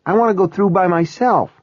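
put-on high, quick child's voice; high-pitched, hurried kid voice; excited little girl. A narrator's reading. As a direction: insist eagerly as a small child. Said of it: "Kyra sounded excited".

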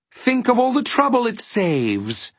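very high, gleeful villain voice; soaring, persuasive villain; scheming demon. A narrator's reading. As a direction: sell it with oily delight. Figure of the demon Trivium.

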